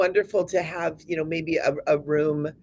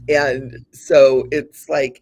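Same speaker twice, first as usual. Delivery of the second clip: ordinary talk, nothing marked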